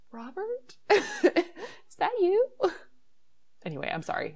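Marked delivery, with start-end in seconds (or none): 0.1-2.5 high, slow questioning call; calling the neighbor. Pretend. [name] pretending to call her vacuuming neighbor, high slow call. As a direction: call through the wall to the neighbor. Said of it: "I just wanna be like".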